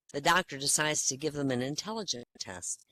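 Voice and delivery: ordinary delivery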